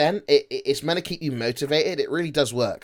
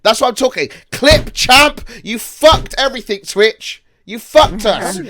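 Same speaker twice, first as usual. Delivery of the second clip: high, loud, emphatic accusation; exasperated, raised-voice telling-off; loud rant; 7 semitones higher, 10 dB louder than usual. Real, not acted. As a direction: call them out at full volume, pitched way up. Ranting at clip channel for drama.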